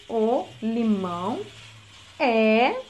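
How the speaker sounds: drawn out slowly while writing; slow dictation. Shown as a demonstration. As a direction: dictate each word to the child. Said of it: "Inicial maiúscula, espaço".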